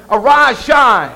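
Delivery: loud, ringing command to rise; commanding call. Acted. Father calls over Jesus in hell, loud command. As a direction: call it out with full force. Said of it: "So he said over him".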